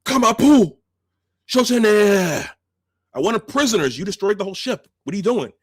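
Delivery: high, loud mock-furious yell; furious Klingon commander. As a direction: berate the gunner like a raging commander. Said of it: "he says".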